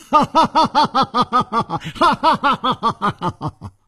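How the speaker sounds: long, high peal of ha-ha laughter; loud hearty laugh. A narrator's reading. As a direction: laugh heartily in a high rapid run, dying away. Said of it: "楚留香大笑着". (放声大笑)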